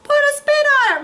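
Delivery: very high, loud and fast; Rumpelstiltskin. Acted. Rumpelstiltskin urging the wolf, high and fast. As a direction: squeak it out quick and high. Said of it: "Rumpelstiltskin suggested".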